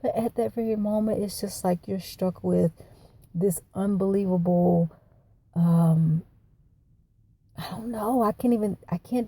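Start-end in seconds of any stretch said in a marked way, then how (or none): none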